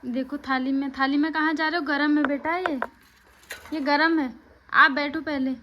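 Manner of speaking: hot, careful now, sit down. Real, not acted. Mother feeding baby porridge.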